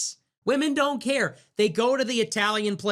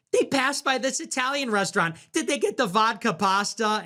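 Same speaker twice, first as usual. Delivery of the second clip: much higher, gushing valley girl imitation; mock girly voice; 7 semitones higher than usual. Streamer mocking Brett Cooper's excited reaction, high girly voice. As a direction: mimic her excited gushing. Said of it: "and she's like".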